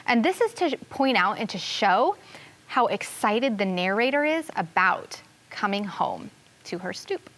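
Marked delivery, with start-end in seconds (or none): none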